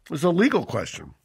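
ordinary talk, nothing marked

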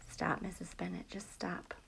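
hushed whisper telling yourself off; self-scolding whisper. Real, not acted. Teacher whispering a joking scolding to herself. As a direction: whisper a playful stop to yourself.

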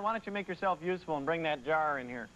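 ordinary delivery

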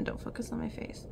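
soft breathy whisper, barely there; whispered aside. Real, not acted. Vlogger muttering an aside to viewers, whispered. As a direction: slip it out under your breath.

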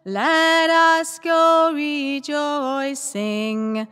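usual speaking voice

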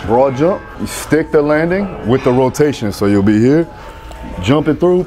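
usual speaking voice

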